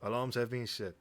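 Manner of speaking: polite French assistant voice; lightly French-accented agent. Acted. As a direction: answer like a French AI butler. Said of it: "He has a French accent?".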